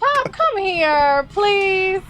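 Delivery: coaxing grandpa into the frame, high and drawn out; calling out. Real, not acted. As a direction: stretch it out and call Pop over. Calling grandfather over to camera.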